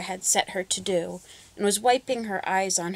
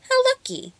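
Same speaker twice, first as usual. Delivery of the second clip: put-on girl's voice; 5 dB louder than usual. A narrator's reading. Little girl voiced high.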